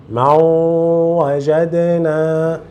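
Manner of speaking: ما وجدنا with a long, level nasal glide into the w; correct merged reading. Shown as a demonstration. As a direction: hold the nasal hum on the join of ما وجدنا. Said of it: "você quer ler corretamente".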